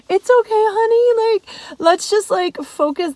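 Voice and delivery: high, gentle soothing dad voice. Retold or imitated. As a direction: soothe her like a calm dad. Dad soothing daughter, retold.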